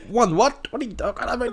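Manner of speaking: high, loud incredulous question; incredulous husband. Pretend. Husband hearing his wife say won, high incredulous voice. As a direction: demand to know what she means.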